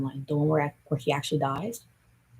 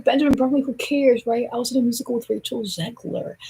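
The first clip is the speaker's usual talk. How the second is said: dismissive; 7 semitones higher, 3 dB louder than usual. Retold or imitated. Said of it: "It's like".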